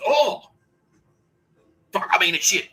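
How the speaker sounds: high loud mock tirade; mock outburst. Creative director trashing the junior's idea, mock angry outburst. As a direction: explode at the pitch in mock fury.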